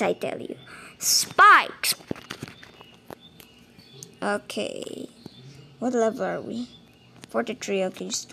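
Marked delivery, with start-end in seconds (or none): 0.0-1.9 hissed dramatic whisper; stage whisper. Pretend. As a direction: whisper it like a secret, then burst.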